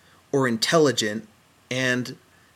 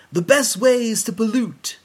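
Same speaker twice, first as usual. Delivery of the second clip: slow, louder, high announcer voice for a public service spot; old PSA announcer; 8 semitones higher, 5 dB louder than usual. Retold or imitated. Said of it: "like one of those old public announcements".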